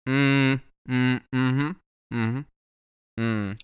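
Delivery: closed-mouth mm-hmm answers; muffled hums. Retold or imitated. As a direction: hum replies through a towel. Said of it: "and I'm just like".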